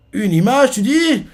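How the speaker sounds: s'offusquer du mot image; énervé. Retold or imitated. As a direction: question qui grimpe très haut. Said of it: "Et tout énervé". (question énervée et aiguë)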